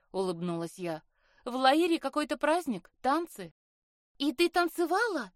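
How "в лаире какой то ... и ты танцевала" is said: high, eager questioning with lifted energy. A narrator's reading. Sister asking about the dances at the festival, eager. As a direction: ask keenly, pitched up and a little louder. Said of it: "жадно спросила Айлин".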